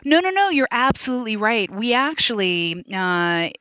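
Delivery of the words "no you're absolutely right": ordinary talk, nothing marked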